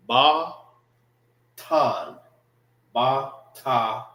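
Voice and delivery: batan split in two, bah... tahn, with a pause; syllable-by-syllable pronunciation. Shown as a demonstration. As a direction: sound out Batan as separate syllables with open 'ah'. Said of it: "it's pronounced together".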